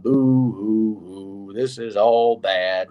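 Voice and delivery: low, slow mock boo-hoo whine; hammed-up low slow gloomy whimper. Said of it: "world is just expecting doom and gloom".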